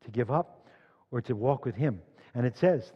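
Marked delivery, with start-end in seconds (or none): none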